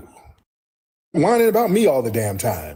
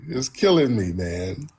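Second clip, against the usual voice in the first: ordinary talk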